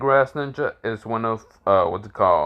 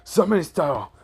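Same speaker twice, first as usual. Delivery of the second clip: jutsu call; 7 semitones higher than usual. Acted. Villain shouts a jutsu.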